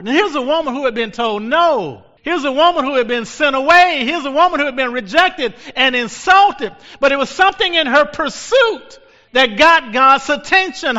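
high, loud sermon cry; fiery preacher rousing the congregation; preaching at full force. Acted. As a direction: drive it home like a revival preacher.

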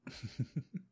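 high, rising giggle; ticklish giggle. Pretend. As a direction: burst of tickled giggling in role.

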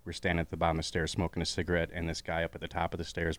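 ordinary talk

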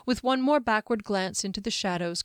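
ordinary delivery, nothing marked

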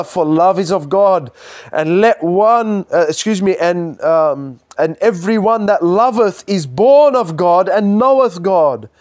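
loud, ringing scripture read; booming Bible reading; fervent scripture reading. Acted. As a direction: proclaim the verse from the pulpit.

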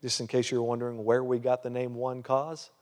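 ordinary talk

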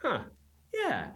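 high, drawn-out dawning huh; surprised. Retold or imitated. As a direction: react like it just clicked. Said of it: "And I'm like".

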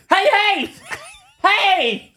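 very high, hammed-up calling out; comic overdone hey, breaking into laughter; mock hailing. Imagining he wound down the window, hammed-up hailing. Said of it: "imagine if I'd wound down the window and said".

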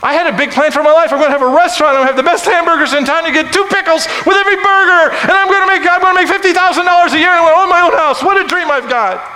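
high, loud shouted sermon; full-force preaching. Real, not acted. Preacher telling the congregation about God's plan and his own, shouted preaching. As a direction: preach it at the top of your voice.

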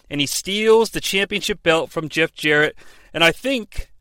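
ordinary delivery

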